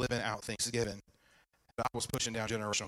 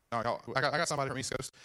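usual speaking voice

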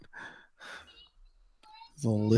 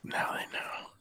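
breathy secretive whisper; worried whisper; 12 dB quieter than usual. Real, not acted. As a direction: hush it like a secret. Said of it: "Why are we whispering?".

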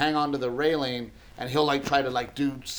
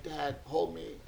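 put-on soft little-boy plea, much softer; small child asking; 10 dB quieter, slower than usual. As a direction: quietly ask like a sick kid wanting a hug. Sick son asking to be held.

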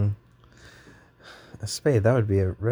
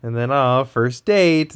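ordinary talk, nothing marked

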